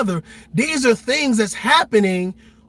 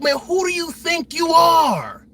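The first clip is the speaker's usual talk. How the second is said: slow, higher, mocking shout; mock challenge; 7 semitones higher, slower than usual. A critic challenging the streamer, mock shouting. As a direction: sneer it out like a heckler. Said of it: "Somebody said".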